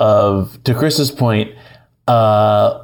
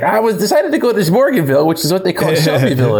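put-on high, fast rambling old man voice; Grandpa Simpson voice; 5 semitones higher, faster than usual. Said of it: "the Grandpa Simpson thing where he's like".

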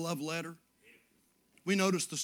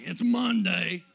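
mock glum coworker; 4 semitones higher, 12 dB louder, slower than usual. Voicing glum coworkers at work.

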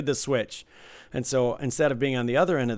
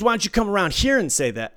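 raised, louder mock dare; angry challenge; 7 semitones higher, 5 dB louder than usual. Dealer acting out a player challenging him, confrontational. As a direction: imitate a player picking a fight.

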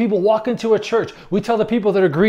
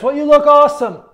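high, loud fake praise; mock admiration; 6 semitones higher, 7 dB louder than usual. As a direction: gush it sarcastically, pitched up. Mocks admiration of showy prayers.

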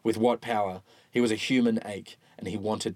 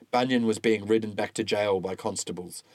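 plain reading voice

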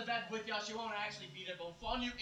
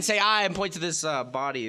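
ordinary talk

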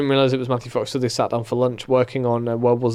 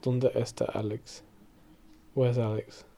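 ghostly hushed call; 10 dB quieter, slower than usual. Retold or imitated. Mimicking the show's ghostly voice.

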